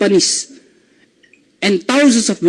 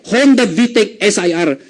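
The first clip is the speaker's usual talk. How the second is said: usual speaking voice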